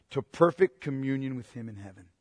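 ordinary talk

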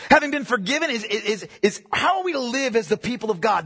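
ordinary talk, nothing marked

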